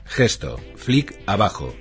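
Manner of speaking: usual speaking voice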